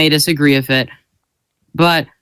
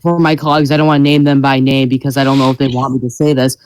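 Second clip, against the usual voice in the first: ordinary talk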